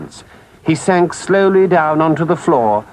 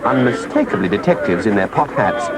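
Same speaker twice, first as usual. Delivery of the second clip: usual speaking voice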